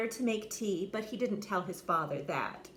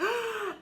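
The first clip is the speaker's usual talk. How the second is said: gasp; 13 semitones higher than usual. Acted. Dragon tries to breathe.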